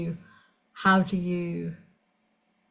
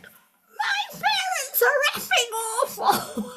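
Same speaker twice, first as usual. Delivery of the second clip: high, slow, crying shout of rage; client's sudden raging outburst, shouting and crying; enraged shout; slower than usual. Retold or imitated. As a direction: explode at the parents through tears. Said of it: "came off the bed in a complete rage".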